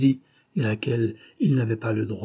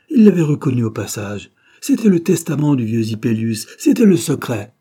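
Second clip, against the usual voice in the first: louder, urgent narration; louder, urgent storytelling; building alarm; 8 dB louder than usual. A narrator's reading. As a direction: drive the shock of the burning will. Climax of the tragedy.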